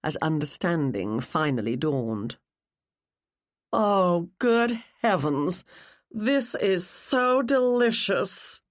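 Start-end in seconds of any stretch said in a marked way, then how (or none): 3.7-8.6 slow, savouring relish, pitched up; delicious, drawn-out glee; gloating delight. A narrator's reading. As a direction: draw it out as if tasting the scandal. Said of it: "A slow smile spread over Rebecca's face".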